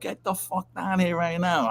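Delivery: high mock barked command; hammed-up boss summoning him; imitating the boss's furious order, pitched high; retold angry order.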